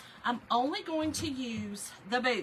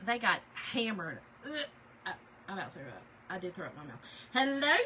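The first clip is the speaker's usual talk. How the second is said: normal talking voice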